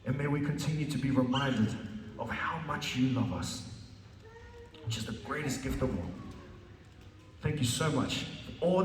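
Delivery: soft, low pastor's prayer; closing prayer. Acted. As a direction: pray quietly over the congregation. Closes sermon with prayer.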